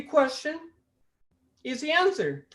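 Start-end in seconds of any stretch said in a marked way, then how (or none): none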